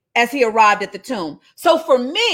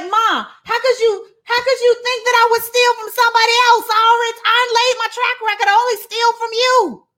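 high-pitched, mock-outraged child; indignant protest; 11 semitones higher than usual. Child protesting to mom about being called a thief, indignant. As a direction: plead your case in a high, insistent voice.

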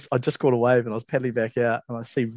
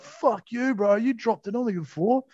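high-pitched mock retort; giving it back; 10 semitones higher than usual. Surfer firing back at his accuser, mock indignant. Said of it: "Rob just gives it straight back".

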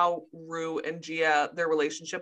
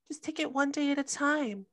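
imitating Cassie; 6 semitones higher, 5 dB quieter than usual. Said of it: "Cassie just decides to be like".

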